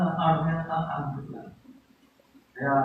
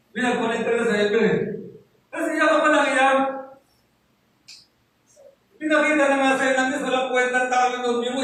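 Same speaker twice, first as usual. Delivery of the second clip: loud, higher scolding pulpit voice; preaching at full force; 5 semitones higher, 4 dB louder than usual. Acted. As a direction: chide the crowd from the pulpit. Preaching to the congregation.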